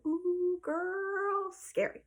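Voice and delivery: put-on high, drawn-out "ooh, girl"; playful warning. Storyteller warns listeners about their temper, playful warning.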